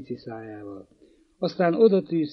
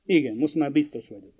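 ordinary delivery

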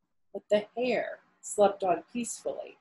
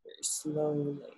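drawn-out slowly; 5 semitones lower, 9 dB quieter than usual. Shown as a demonstration. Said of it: "how does a tortoise move?".

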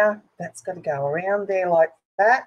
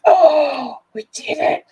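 excited cry; 10 semitones higher, 9 dB louder, slower than usual. Real, not acted. Finishing the fiddly chain fix.

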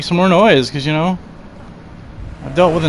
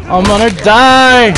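loud, higher panic cry; panicked yell; 5 semitones higher, 4 dB louder than usual. Real, not acted. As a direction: yell it in real fright. Panicked yelling in game.

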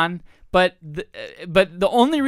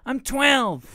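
imitated innocent kid; twelve-year-old kid; 4 semitones higher than usual. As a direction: answer like a little kid. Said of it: "this kid was asking".

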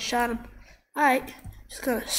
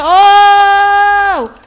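long, high scream; high drawn-out yell; loud scream; 8 semitones higher, 15 dB louder than usual. Real, not acted. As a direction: scream out mid-fight, holding it long. Cry while fighting in the game.

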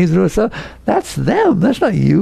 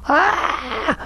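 mock anguished cry; 12 semitones higher, 4 dB quieter than usual. Listener reacts to nothing being personal, mock anguished cry. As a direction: cry out in put-on protest, high and held back.

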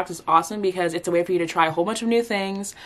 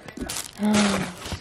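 sharp happy gasp; surprised gasp; 4 dB quieter than usual. Real, not acted. Opening a surprise package.

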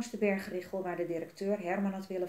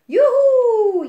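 very high, loud drawn-out whoop; jubilant cheer; 19 dB louder than usual. A narrator's reading. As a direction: cheer like setting off on a ride. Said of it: "juichte Jelmer".

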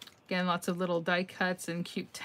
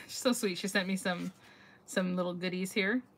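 ordinary talk

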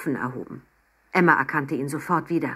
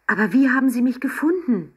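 higher, excited question; calling out; 7 semitones higher, 4 dB louder than usual. A narrator's reading. Girl asks the old man how he found her, exclaiming. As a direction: blurt the question out in amazement. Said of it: "rief sie".

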